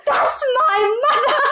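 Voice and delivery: high, drawn-out cry through laughter; laughing mock wail. Daughter reclaiming the urn, mock outraged wail through laughter. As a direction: wail it slowly, cracking up.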